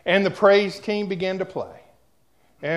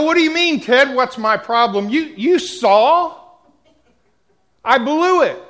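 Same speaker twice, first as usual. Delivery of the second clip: higher and louder, anguished protest; distraught outburst; 6 semitones higher, 6 dB louder than usual. Retold or imitated. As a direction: pour out the despair at Ted. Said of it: "I'm still crying".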